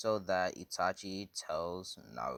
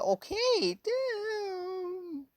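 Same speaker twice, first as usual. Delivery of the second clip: very high whiny falsetto wail; hammed-up crying, last word drawn out; mock tearful surrender; 6 dB louder, much slower than usual. Beaten woman gives in to the threat, high mock crying.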